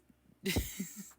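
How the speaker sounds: loud, high-pitched giggling burst; high laugh. Real, not acted. Laughs after getting it all out.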